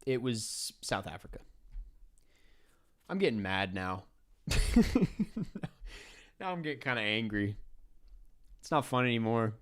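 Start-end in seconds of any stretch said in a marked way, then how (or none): 4.5-6.0 breathy, airless high laugh; exasperated laugh. Real, not acted. Gamer laughing at getting mad, a breathy laugh. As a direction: laugh it off in frustration.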